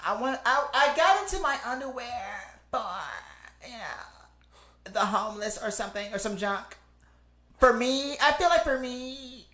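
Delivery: high, whiny mock socialite; hammed-up high celebrity whine; whiny Kardashian impression. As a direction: whine it high, as a send-up of a reality star. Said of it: "that real whiny".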